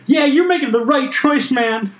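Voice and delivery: high, eager sidekick voice; squeaky yes-man agreeing; high henchman voice. Acted. Henchman agreeing in acted scene.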